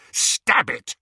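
loud, high, urgent command; harsh order. A narrator's reading. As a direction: order a friend to strike at once. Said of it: "Harry said harshly".

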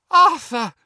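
high, loud tearful cry; sobbing cry. A narrator's reading. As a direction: cry out the name through sobs. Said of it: "sobbed Missus Weasley".

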